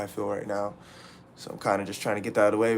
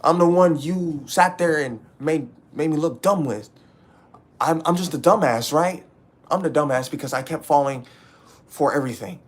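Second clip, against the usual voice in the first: raised, louder and higher, accusing; angry outburst; 5 semitones higher, 6 dB louder than usual. Real, not acted. Ranting at his ex, loud and angry.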